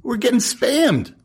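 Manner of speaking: high, giddy squeal with laughter; stretched-out, laughing fake thrill; mock delight. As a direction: gush in a hammed-up high voice. Mock delight at being spammed.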